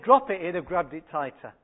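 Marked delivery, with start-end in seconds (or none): 0.0-0.4 louder, higher sharp order; stern command. Retold or imitated. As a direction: order him to drop it firmly. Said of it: "If I'd have said".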